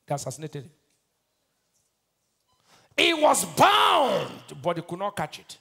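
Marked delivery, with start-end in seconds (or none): 3.0-4.4 loud, high cry with BOUND stretched long; drawn-out pulpit shout; emphatic preaching shout. Real, not acted. Preacher drives home key word.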